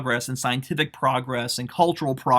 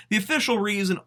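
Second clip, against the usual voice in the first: official lifted higher, leaning on it; stressing official; 6 semitones higher, faster than usual. Real, not acted. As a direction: press hard on the word official. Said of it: "I stress the official reason here".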